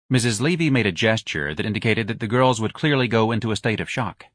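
ordinary delivery

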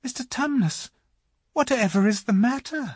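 high-pitched, worried Lucy; concerned little girl. A narrator's reading. Lucy asking the tearful faun what is wrong, concerned. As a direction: ask anxiously in a high girl's voice.

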